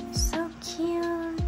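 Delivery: high, sustained coo of delight. Real, not acted. Vlogger admiring the puzzle from her album, delighted.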